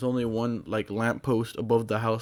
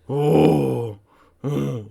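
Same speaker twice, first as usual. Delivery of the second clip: imitated eerie moan; 3 semitones higher, 6 dB louder than usual. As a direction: mimic a ghostly noise swelling out of the basement. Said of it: "and I hear".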